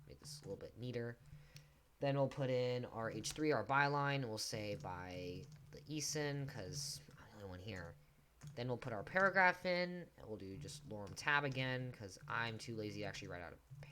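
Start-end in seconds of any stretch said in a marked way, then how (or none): 6.5-8.0 whispered aside. Real, not acted. Joking aside to the viewer.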